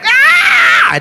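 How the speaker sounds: very high imitated child's shriek; piercingly high mock tantrum yell; child's rebellious scream. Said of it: "Kalau dia teriak".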